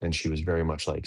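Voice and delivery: ordinary talk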